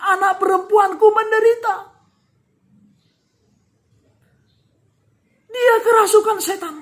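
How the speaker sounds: high, loud tearful pleading; woman wailing to Jesus for her daughter; crying out loudly. Acted. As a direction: cry out through tears for mercy. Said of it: "berseru dengan suara nyaring sambil menangis".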